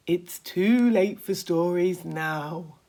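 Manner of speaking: a slow, lightly voiced scornful old grasshopper; deep slow scornful. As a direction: draw it out with scorn. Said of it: "the old green grasshopper announced".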